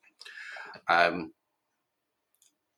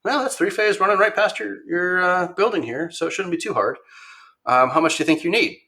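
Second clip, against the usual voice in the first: much higher, easy upbeat voice; upbeat engineer; 9 semitones higher, 4 dB louder than usual. Retold or imitated. Engineer tells the shop owner power is right there, upbeat and high. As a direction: play the engineer who finds it simple.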